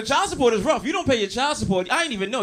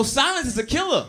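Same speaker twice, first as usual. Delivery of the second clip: normal talking voice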